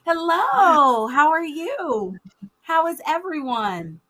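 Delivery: high, slow, sing-song greeting; kindergarten teacher voice. Real, not acted. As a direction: greet them like a class of five-year-olds. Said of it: "if I sound too much like a kindergarten teacher".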